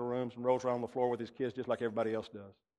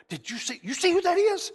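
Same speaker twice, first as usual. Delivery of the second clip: high, loud, excited whisper-shout of a wife; 7 dB louder than usual. Retold or imitated. Wife elbowing her husband at the preacher, high and excited. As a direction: play the wife nudging him, eyes wide.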